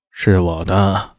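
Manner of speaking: slow, drawn-out, half-dead drawl; slow and listless. A narrator's reading. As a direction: drag the words out lifelessly. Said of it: "慢慢悠悠地".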